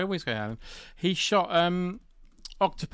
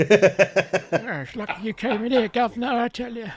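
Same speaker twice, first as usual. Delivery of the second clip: put-on higher pitched, chirpy old-school film-crew gent; old-fashioned stock voice; 9 semitones higher, 5 dB louder, slower than usual. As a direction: play the eager old cameraman calling everyone Governor. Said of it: "That's, that's a good voice".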